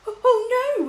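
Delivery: high, loud frightened whimper; a terrified king whining in despair. A narrator's reading. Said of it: "whimpered the king".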